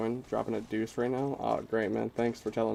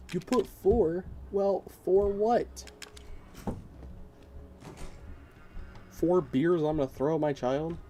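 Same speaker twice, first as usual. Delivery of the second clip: a mock teacher, higher and drawn out; exasperated math teacher; 6 semitones higher, slower than usual. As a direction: scold a pupil over a wrong answer, half-joking. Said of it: "I feel like your fucking math teacher".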